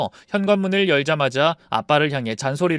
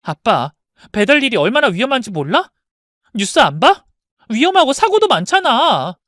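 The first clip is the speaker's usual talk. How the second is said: louder anxious nagging; worried scolding; 7 semitones higher, 7 dB louder than usual. Acted. Daughter scolding father about delivery risks, louder worried. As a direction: press him hard about the danger.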